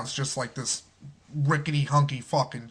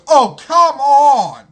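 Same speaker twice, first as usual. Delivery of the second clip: loud, high, drawn-out groan of protest; high exasperated yell, slow and loud; exasperated dwarf; 10 semitones higher, 12 dB louder, much slower than usual. Retold or imitated. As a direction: cry out in exasperation, stretching the words. Voices exasperated dwarf crushed by goblin.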